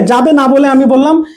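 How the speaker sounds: usual speaking voice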